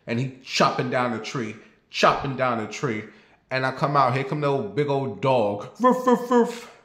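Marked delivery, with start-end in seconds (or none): 5.8-6.7 high, louder mock yappy barks; imitating the neighbor's pit bull barking; dog bark imitation.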